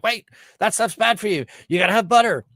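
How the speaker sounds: high-pitched mock urgent warning; mock alarm. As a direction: imitate experts suddenly reversing themselves. Mocks experts reversing their advice.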